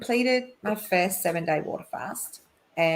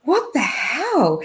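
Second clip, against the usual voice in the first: laughing disbelief; 3 semitones higher, slower than usual. Retold or imitated. Waking at 3am crying.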